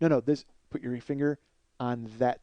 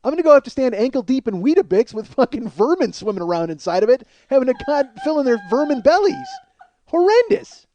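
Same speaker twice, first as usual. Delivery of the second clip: high, loud comic tirade; horrified rant; 9 semitones higher, 7 dB louder than usual. Acted. Comedian dreading vermin at the mic stand, horrified rant. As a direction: build to an appalled outburst.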